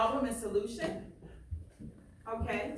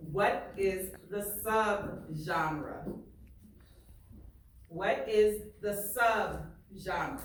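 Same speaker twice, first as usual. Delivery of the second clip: slow, deliberate question, leaning on each word; slow emphasis; slower than usual. Shown as a demonstration. Teacher asking her class the key question, slow and deliberate. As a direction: spell the question out slowly.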